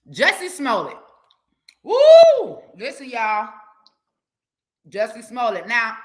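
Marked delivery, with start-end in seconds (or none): 1.8-2.6 high, loud celebratory woo; excited whoop. Real, not acted. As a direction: let out a big happy whoop. Excited whoop after the name.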